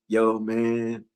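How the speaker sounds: low, soft and slowed, laid-back drawl; soft Shaquille impression. Preacher mimicking Shaquille greeting a teacher, low and soft. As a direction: play it super chill and mellow, like a gentle giant. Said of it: "Shaquille be talking all soft".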